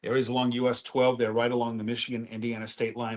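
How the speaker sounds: news anchor read. Acted. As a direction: open the weather report on air. On-air weather update.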